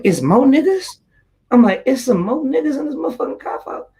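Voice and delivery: high, alarmed and disbelieving; alarmed disbelief. Retold or imitated.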